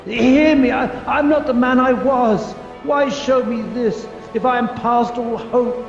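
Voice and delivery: urgent, impassioned begging; frantic, forceful plea; desperate pleading. Acted. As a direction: beg for your life with rising insistence. Pleads with the spirit for mercy.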